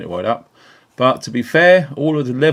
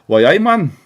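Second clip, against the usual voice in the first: higher, louder Geordie catchphrase; mock Geordie accent; 7 semitones higher, 5 dB louder than usual. Greeting Newcastle viewers in a mock Geordie accent. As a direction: call it out in a put-on Newcastle accent.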